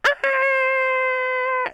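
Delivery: high, loud mock scream; imitated yell. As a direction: imitate a startled woman's drawn-out yell. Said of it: "you hear her yell".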